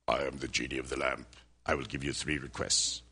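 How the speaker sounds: deep, booming genie of the lamp; low, hushed genie voice. Acted.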